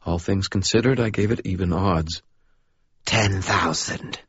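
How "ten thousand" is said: slow, soft murmur with an odd foreign lilt; soft sibilant voice. A narrator's reading. Unseen caller on the phone, slow sibilant murmur. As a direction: purr it slowly like an unsettling stranger. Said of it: "said the voice".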